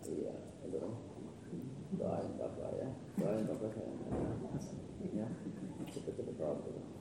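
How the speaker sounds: soft, low, tender murmur into the phone; quiet phone call. Real, not acted. Father quietly reassures his daughter on the phone, soft and tender.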